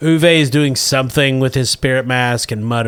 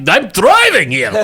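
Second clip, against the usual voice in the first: mock New York driver; 7 semitones higher, 4 dB louder than usual. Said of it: "I was like".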